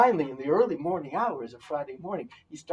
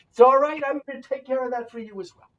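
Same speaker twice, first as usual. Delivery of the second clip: reassuring; 9 semitones higher, 10 dB louder than usual. Acted. Rabbi reassures woman.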